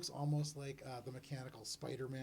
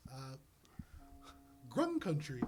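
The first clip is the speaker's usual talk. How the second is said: Glub; 6 dB louder than usual. Acted. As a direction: blurt it out as a small critter. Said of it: "Glub looks up and goes".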